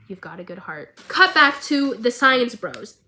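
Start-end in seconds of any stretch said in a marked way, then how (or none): 0.1-1.0 soft fatherly echo. Retold or imitated. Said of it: "we can hear Vander's voice".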